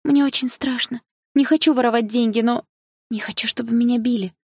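high, anxious schoolgirl voice; scared girl opening up; frightened confession. A narrator's reading. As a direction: plead fearfully, pitch held high. Frightened schoolgirl confesses fear of beating.

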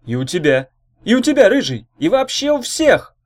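orator's swelling, high proclamation; rousing shout. Acted. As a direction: shout it out to the whole crowd, rising. Orator shouts promise to everyone.

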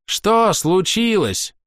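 haughty elf, high ringing voice; theatrical, scornful ranting; proud elf. Acted. Castiel speaks.

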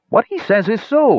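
very high-pitched defender; high character voice. Acted. Little Shoulders backs the dwarf, high character voice. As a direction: pipe up in a high voice.

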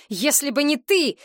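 high, sharp stress on ТЫ; angry cry. A narrator's reading. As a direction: hurl ТЫ at her, voice up high. Oleg repeats his accusation. (резкий выкрик, ударение на «ты»)

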